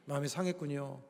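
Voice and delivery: soft, low words of comfort; gently tend to a hurt heart; soothing sympathy. Shown as a demonstration. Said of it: "마음은 어루만져줘야 돼요".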